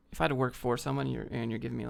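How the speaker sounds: ordinary talk, nothing marked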